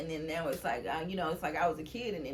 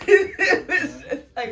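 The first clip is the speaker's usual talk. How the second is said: louder, breaking into real laughter; laughing; 10 semitones higher, 13 dB louder, slower than usual. Real, not acted. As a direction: laugh your way through the words. Laughing at own story.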